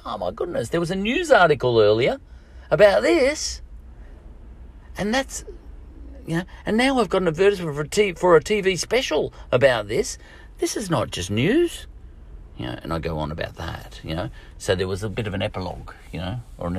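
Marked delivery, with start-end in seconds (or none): 0.0-11.9 high, rising mock outrage; acted astonishment. Retelling his reaction to a news article, high astonishment. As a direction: build from surprise into high-pitched incredulity. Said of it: "And I went".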